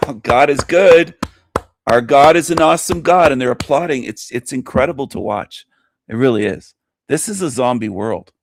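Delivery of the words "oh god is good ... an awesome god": mock worshippers. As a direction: parody a rapt congregation. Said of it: "And people are like".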